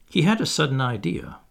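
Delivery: ordinary delivery, nothing marked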